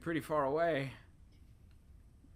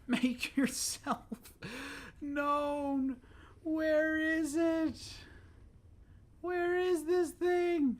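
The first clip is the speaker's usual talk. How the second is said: very high, slow drawn-out call; mock summoning call; 14 semitones higher, much slower than usual. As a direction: call out like a ghost demanding it appear. Continues the mock calling.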